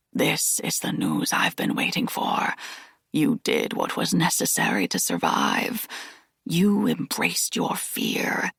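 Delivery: put-on rasping old Aslan.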